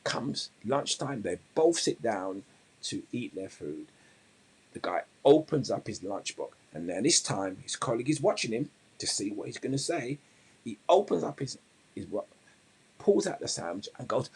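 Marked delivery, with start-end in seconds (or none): none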